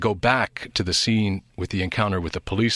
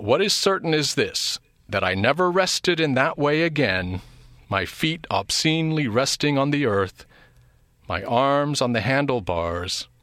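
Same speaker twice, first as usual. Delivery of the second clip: raised, lively read-aloud voice; dramatic reading; 4 semitones higher than usual. Acted. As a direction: perform the novel's lines with a higher, livelier voice. Quotes novel passage.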